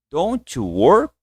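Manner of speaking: very slow "don't you work?" with a rise at the end; slow model. Shown as a demonstration. Teacher repeats the model question, slow and rising. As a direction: draw it out slowly for learners to copy. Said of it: "Então, ó".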